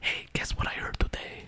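put-on conspiratorial whisper, Mexican accent; creepy Mexican whisper. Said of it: "creepy Mexican whisper boy".

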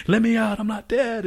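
high-pitched mock panicked cry; imitated frantic yell; acted-out panic. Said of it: "hear him, like, panicking".

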